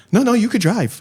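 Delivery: high-pitched imitation, laughing at the edges; impressed caller. Comedian imitating the Chinese client after the reverse-driving test, high and hasty.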